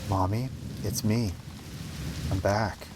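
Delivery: soft, slow small voice; hushed, hopeful little girl; timid child. Acted. Child returns home timidly.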